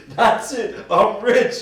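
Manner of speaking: high, loud boast; cocky excited shout. Retold or imitated. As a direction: yell it like you just got rich. Friend quoting his cocky attitude.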